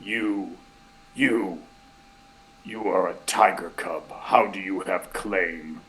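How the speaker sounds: lightly voiced presence, slow and low; clearer, a little hearty, weighing each word; hearty spirit voice. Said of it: "like, hearty, but it's just like".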